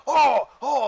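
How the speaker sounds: high, loud burst of delighted oohing; excited orcs. Acted. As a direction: gasp out thrilled cries like a crowd opening gifts. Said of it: "like, excited".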